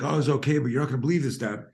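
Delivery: high, loud and eager; excited kid telling dad. Retold or imitated. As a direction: blurt out the news to dad. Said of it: "I was like".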